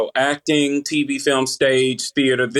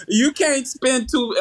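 laughing; 10 semitones higher, slower than usual. Real, not acted. Laughing at own accent joke.

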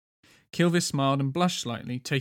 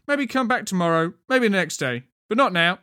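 high, loud guard voice; 5 semitones higher, 6 dB louder than usual. A narrator's reading. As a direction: turn them away loudly, like a sentry. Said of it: "the guard said".

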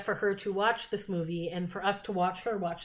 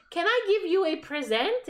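put-on squealing, much higher girly voice; high excited fan voice; 10 semitones higher, 3 dB louder than usual. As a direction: gush like a starstruck fan. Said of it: "she's like".

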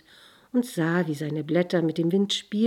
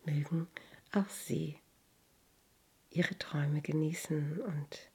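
whispered blessing. Real, not acted. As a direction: whisper a gentle blessing to the listener. Closing blessing to listeners.

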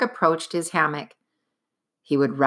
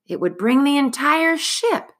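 ordinary delivery

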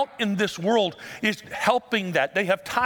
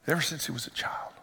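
whispered, worn-out father; exhausted whisper; 3 semitones lower, 6 dB quieter than usual. Retold or imitated. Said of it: "can you feel the exhaustion in the dad's voice".

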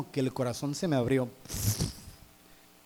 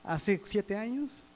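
higher and softer, tenderly reminding; God's voice; 4 semitones higher, 4 dB quieter than usual. Retold or imitated. God reminding the preacher in the shower, higher and softer. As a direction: gently recall the closeness of seven years ago. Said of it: "Dios me estaba diciendo".

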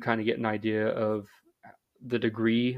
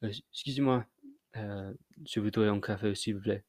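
softer, tentative French with French sounds; hesitant French order; 6 dB quieter than usual. Shown as a demonstration. Tourist ordering coffee in Paris, hesitant French. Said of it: "order a cup of coffee in French".

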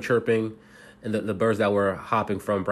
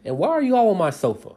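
mock startled man finding his lawn full; surprised Mister Barry; 14 semitones higher, 3 dB louder than usual. Mister Barry finds everyone on his sofa, higher startled shout. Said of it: "he asked".

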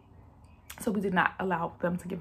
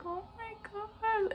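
higher, hushed and slow oh my God; awed; 14 semitones higher, 6 dB quieter, slower than usual. Retold or imitated. Mother seeing her newborn son, hushed awe. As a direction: let it out softly in wonder.

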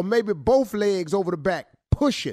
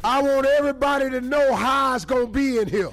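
hype shout; 5 semitones higher than usual. Acted. Hype announcement.

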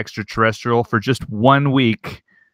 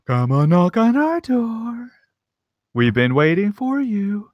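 slow, high, lightly spooky singsong; haunted house actor; 9 semitones higher, slower than usual. Acted. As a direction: lure them in like a haunted house greeter. Creepy twist on the theme.

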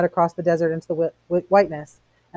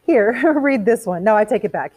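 louder, higher, with a giggle; laughing; 6 semitones higher, 6 dB louder than usual. A narrator's reading. Mildred snatching the book from Montag, laughing. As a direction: brush it off with a bright laugh. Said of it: "snatched the book with a laugh".

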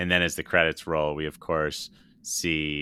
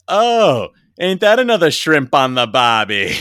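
loud, high hammed-up Australian accent; 8 semitones higher, 12 dB louder than usual. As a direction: belt it out in a cartoonish Aussie accent. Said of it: "of course, that's an Australian".

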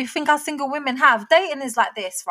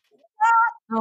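shocked what; 10 semitones higher than usual. Real, not acted. Reacting to outrageous question.

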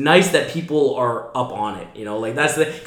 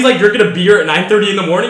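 ordinary delivery

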